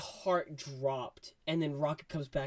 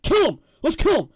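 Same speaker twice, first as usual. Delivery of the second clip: loud, much higher bark; Drax shouting; 16 dB louder than usual. Retold or imitated. Drax urging the kill, shouting. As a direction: demand it savagely. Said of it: "Drax is like".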